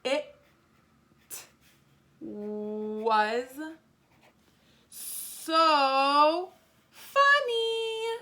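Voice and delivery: very slow, rising to high and loud; stretched-out writing pace. Shown as a demonstration. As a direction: stretch each word, building to an exclamation. Writing the exclamation sentence slowly.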